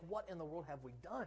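soft, inward question; quiet dismay; hushed dismayed thought. Acted. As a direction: think it aloud under your breath. Voicing a private dismayed thought.

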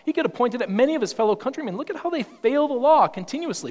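animated accusation; rising indignation. Acted. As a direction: build up fast and high. Pointing at failing countrymen.

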